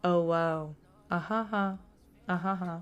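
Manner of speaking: deadpan, drained of all life; dead, monotone delivery. Shown as a demonstration. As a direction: say the cutesy words completely lifelessly. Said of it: "say it in a really dead voice".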